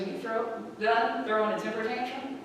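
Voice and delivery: soft, understated reply; quiet retort. Retold or imitated. Friend answers the racer's temper tantrum, quiet retort.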